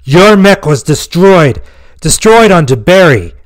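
loud, high, slow angry outburst; angry disbelief. Acted. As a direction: spit the words out in furious disbelief. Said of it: "He angrily spat out in disbelief".

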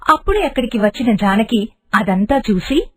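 ordinary delivery

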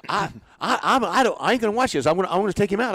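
high, loud agitated manager voice; high excited impression of an irritated manager; imitating Bobby Cox. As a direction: mimic the fired-up skipper snapping back.

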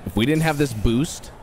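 normal talking voice

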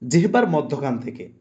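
ordinary talk, nothing marked

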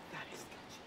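hushed mock whisper of a spooked guy; whispered quote. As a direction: whisper it, unvoiced. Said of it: "he was like".

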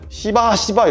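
high taunting 시바 without the final l; mock Chinese accent taunt; chinese accent korean. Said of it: "이러는 거야".

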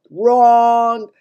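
very loud, high, held word; giant's cry. A narrator's reading. Giant contradicting Sophie, loud drawn-out cry. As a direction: shout it long and high. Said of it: "cried the giant".

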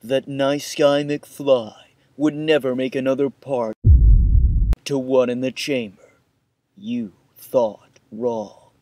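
trailer voice. Acted. Dramatic trailer-style intro.

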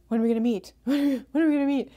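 high, loud, jokey nagging; hammed-up impatient begging with a laugh; mock pestering. Mom joking about messaging her estranged child, mock pestering.

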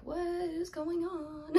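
high, drawn-out comic wail; mock bewilderment. Recalling with partner how bad symptoms got, mock bewilderment. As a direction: act out baffled exasperation, high and slow. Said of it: "And we were like".

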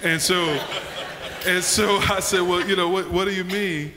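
high, loud, laughing retelling; can barely get the words out for laughing; laughing through the story. Real, not acted. Pastor laughing while retelling the dinner story to his cohosts, high and loud.

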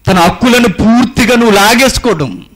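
loud, high, urgent sermon warning; fiery preaching. Acted. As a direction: thunder the warning at the congregation. Preaching climax. (గట్టిగా హెచ్చరించే ప్రసంగం)

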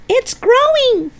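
put-on very high boyish cry; excited yell. George sees the hen grow, yelling excitedly. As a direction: yell the news, thrilled and high. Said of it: "he yelled".

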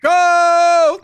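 long, high shouted signal; big drawn-out holler to kick off; starting shout. Real, not acted. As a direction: yell the start of a race at full volume. Host starts the timed contest.

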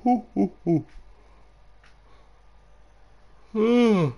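loud high whooping hoo; loud hoots. Real, not acted. Tired streamer hooting.

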